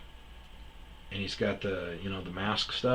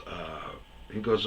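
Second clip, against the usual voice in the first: normal talking voice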